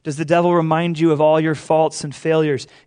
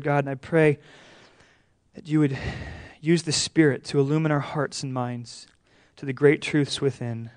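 soft, lowered praying voice; prayer; 4 semitones lower, 6 dB quieter than usual. Real, not acted. Pastor praying to the Father before opening the word, hushed prayer. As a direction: pray quietly and reverently to the Father.

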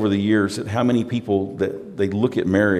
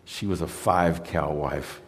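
tender pride; 5 semitones lower, 8 dB quieter than usual. Retold or imitated. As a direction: speak of a wife he loved dearly. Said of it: "He goes".

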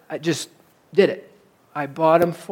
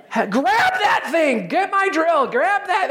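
high, urgent mock yell for help; calling the son in, pitched way up and hammed-up; retold yelling; 9 semitones higher than usual. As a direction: holler at your son to hurry. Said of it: "I'm yelling for Hudson".

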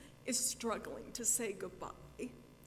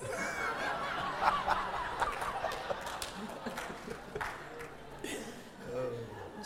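long high-pitched laugh; 5 dB louder than usual. Real, not acted. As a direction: burst into a squealing laugh. Laughs after teasing.

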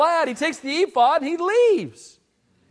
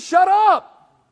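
loud, high barked order; mock yell; 7 semitones higher, 6 dB louder than usual. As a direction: bark it as a threat. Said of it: "They said".

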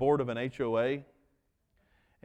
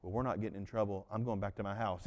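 nervous neighbors backing off; 8 semitones lower, 8 dB quieter, faster than usual. Retold or imitated. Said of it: "the neighbors were like".

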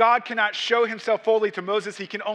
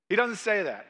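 ordinary talk, nothing marked

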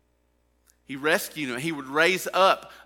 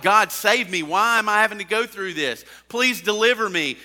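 loud, higher plea for rescue; pleading cry; 7 semitones higher, 9 dB louder than usual. Retold or imitated. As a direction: beg God to get you out. Imagined plea to God.

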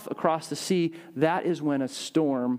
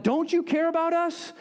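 much higher, pleading mock outcry; retold crying out; 12 semitones higher than usual. Disciples cry out to Jesus in the storm, high imitated plea. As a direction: cry out in alarm as the frightened disciples, without real shouting. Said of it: "And so they cry out".

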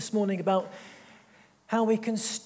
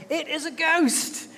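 higher, slow cry of alarm; frightened disciples; 8 semitones higher, slower than usual. Acted. Disciples crying out at a figure on the sea, hammed-up fear.

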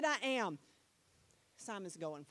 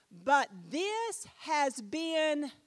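slow, louder build-up; 3 semitones higher, 7 dB louder, much slower than usual. Acted. Building to the key word.

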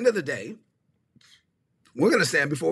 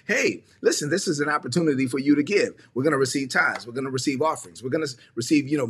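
normal talking voice

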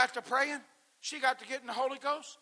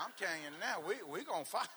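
Daryl; 5 semitones lower, 5 dB quieter than usual. Retold or imitated. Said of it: "That Daryl come out in me".

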